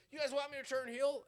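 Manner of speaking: high, loud, challenging question to the crowd; mock promo challenge; challenging Cody. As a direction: throw the question back at fans. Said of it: "all of a sudden he's like".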